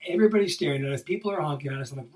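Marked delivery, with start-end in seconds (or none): none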